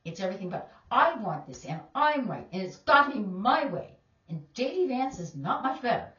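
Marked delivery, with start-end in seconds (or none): 0.9-4.0 loud, mocking I-want-it-my-way voice; hammed-up entitled insistence; mock demanding. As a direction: parody a spoiled demand, loud. Mocking Trump's selfish mentality.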